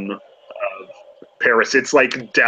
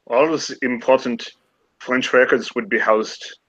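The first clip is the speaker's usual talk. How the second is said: put-on French accent that slides into German; a scholar gone accidentally German; German-leaning accent. Remy describing the records, accent slipping German. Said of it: "God, I keep going German".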